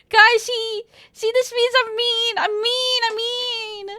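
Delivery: high whine with stretched vowels; playful wailing. Real, not acted. Streamer tells chat they are mean, wailing. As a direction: whine it to the crowd in mock despair. Playfully lamenting having caused tears.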